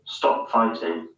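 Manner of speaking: British hard T in "fighting", drawn out; British accent. Shown as a demonstration. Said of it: "In the U K".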